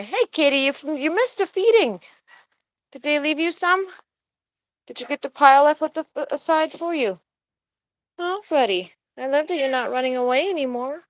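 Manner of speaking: high, sing-song voice for a kitty; cat-talk voice. Real, not acted. Talking sweetly to her cat.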